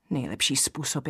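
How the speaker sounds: usual speaking voice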